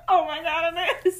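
sudden shocked recognition; surprised. Real, not acted. Realizing the movie comparison.

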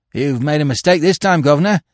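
cornered thief cries out fiercely at the detective; fierce cry. A narrator's reading. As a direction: fling it at him defiantly. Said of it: "cried Bill fiercely".

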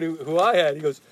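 usual speaking voice

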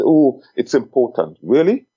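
ordinary talk, nothing marked

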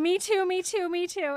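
put-on keen kid jumping into the fray; eager Neville.

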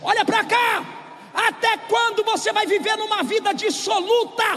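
high, forceful rebuke; preaching shout. Acted. Pastor rebuking the congregation, forceful shout.

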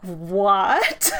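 loud, startled what; surprised what. Retold or imitated. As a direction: blurt it out in disbelief. Shocked at friend's question.

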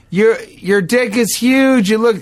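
high, drawn-out taunt with little extra volume; mock yell. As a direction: stretch it out high, as if calling over engines. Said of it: "yell that at someone on a motorcycle".

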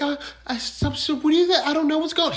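high-pitched stammering imitation; mock flustered wife. Wife denying it to her husband, mock flustered innocence.

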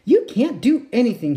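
high, loud huff; frustrated shout. Acted. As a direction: snap it out in frustration. Frustrated boy voice.

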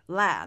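ラ as English 'læ' with a wide vowel; American A syllable. Shown as a demonstration. Teacher points out the weak syllable, modelling wide læ. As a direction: open ラ out into a wide cat vowel. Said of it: "ラのところの母音が足りずに".

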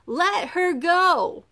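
slow, high, forceful shout; loud drawn-out command to release someone; crying out. A narrator's reading. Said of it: "a voice from behind them cried".

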